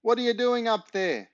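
high voice calling up from below; Dad shouting up from downstairs, high voice; called out. Acted. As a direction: call up the stairs, high-pitched. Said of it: "came a shout from downstairs".

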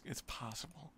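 ordinary talk, nothing marked

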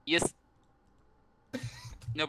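usual speaking voice

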